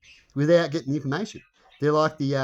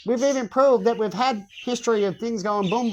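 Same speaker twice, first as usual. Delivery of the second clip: ordinary talk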